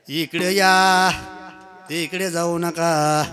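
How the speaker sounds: slow, stretched-out call; long held summons; calling out directions. Real, not acted. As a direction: drag out the final vowels to direct a crowd. Directing people across the hall.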